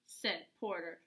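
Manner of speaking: ordinary delivery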